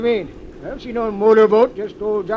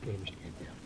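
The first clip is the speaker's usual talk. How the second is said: barely audible reply; whispered; 11 semitones lower, 18 dB quieter, faster than usual. Acted. Boatman answering the detective while creeping aboard, whispered. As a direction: answer so softly nobody aboard hears. Boarding the houseboat quietly.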